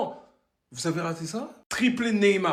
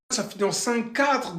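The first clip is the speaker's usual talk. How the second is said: ordinary talk, nothing marked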